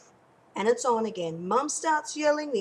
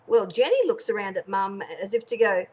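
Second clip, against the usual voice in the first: ordinary talk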